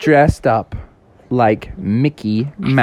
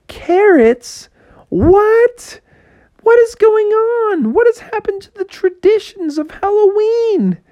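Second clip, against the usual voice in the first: much higher indignant cries; high squeaky disbelief at the treats; outraged surprise. Acted. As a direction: protest the carrots. Kids outraged after door closes.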